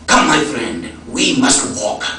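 put-on friend's Ethiopian accent, measured and kindly inviting; German de Sasa's accent. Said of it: "I do a pretty good German accent".

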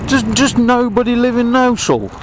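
squeaky mock-baffled complaint; incredulous high voice. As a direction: pitch way up in mock disbelief. Exploring the empty town.

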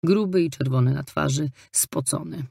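plain reading voice

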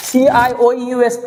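ordinary delivery

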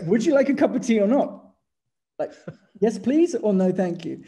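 half-laughing, pressing for a proper answer; laughing exasperation. Retold or imitated. To his Dutch partner, mock exasperated and laughing. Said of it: "And I'd be like".

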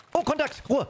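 high, alarmed call-out; sudden alert to enemy; panicking gamer. Real, not acted. Enemy contact spotted.

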